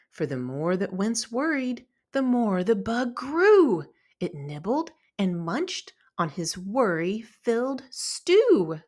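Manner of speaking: usual speaking voice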